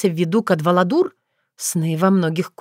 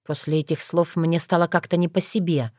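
usual speaking voice

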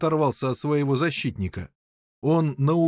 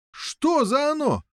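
high, drawn-out question; puzzled chorus; 13 semitones higher, slower than usual. A narrator's reading. Prisoners asking the excited king, high puzzled question. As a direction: ask slowly at a high pitch. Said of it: "спросили все".

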